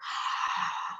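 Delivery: soft, breathy demonstrated yawn; long, quiet yawning exhale. Shown as a demonstration. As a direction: act out a sleepy yawn with no words. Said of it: "that is yawning".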